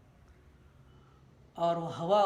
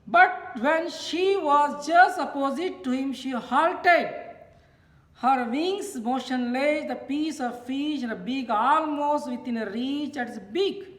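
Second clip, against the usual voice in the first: louder, higher, slow read-out; declamatory reading aloud; 6 semitones higher, 7 dB louder, much slower than usual. A narrator's reading. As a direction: read it out slowly to a class, projecting. Reads lesson text aloud.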